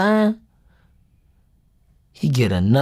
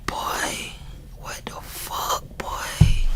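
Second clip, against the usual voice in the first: hushed whisper of disbelief, near tears; shocked whisper; 8 dB quieter, much slower than usual. Retold or imitated. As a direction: whisper it, stunned and scared. Retold shock at raid news.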